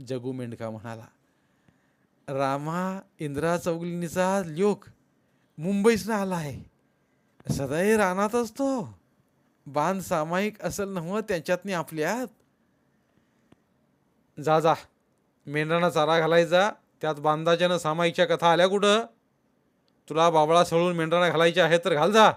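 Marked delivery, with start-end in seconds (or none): 2.3-12.3 higher, louder villager's voice; rustic shepherd explaining. A narrator's reading. Shepherd figure in story. 14.4-22.3 loud, raised impatient command; dismissive order. A narrator's reading. Master orders shepherd to go feed the sheep, loud and dismissive.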